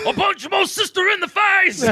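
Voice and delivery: high mock yell of a drunk brawler; hammed-up drunk brawler shout. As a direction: ham up a pub fighter. Mocking drunk Irish brawler.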